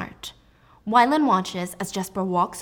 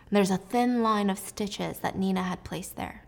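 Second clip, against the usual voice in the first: usual speaking voice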